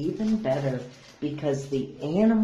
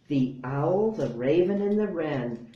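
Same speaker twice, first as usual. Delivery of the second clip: plain reading voice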